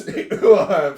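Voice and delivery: a burst of real, loud laughter. Real, not acted. Laughing while reading a viewer's chat.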